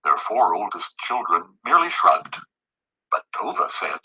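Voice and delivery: usual speaking voice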